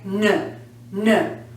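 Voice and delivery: low voiced "nn" for "n"; isolated N sound. Shown as a demonstration. Teacher showing the last sound vibrates, voiced "n". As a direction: hum "n" briefly, not the letter name. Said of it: "like, hear it".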